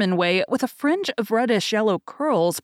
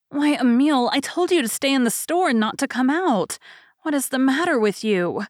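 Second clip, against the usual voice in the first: higher, louder sisterly scolding; scolding big sister; 6 semitones higher, 3 dB louder than usual. Acted. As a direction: chide the little brother firmly.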